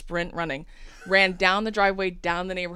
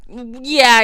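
loud higher sarcastic yeah; dismissive yeah; 6 semitones higher, 13 dB louder than usual. Retold or imitated. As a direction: toss it off. Cop brushing off.

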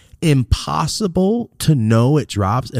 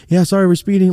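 high, louder apologetic innocence; hammed-up innocence; 10 semitones higher, 6 dB louder, faster than usual. Apologizing to the cop for speeding, hammed-up innocence. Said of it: "I was trying to play it up".

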